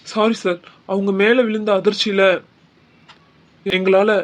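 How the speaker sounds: usual speaking voice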